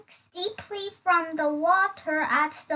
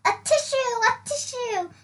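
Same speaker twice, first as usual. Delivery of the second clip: mock sneeze words; 7 semitones higher, faster than usual. Sneeze imitation in riddle.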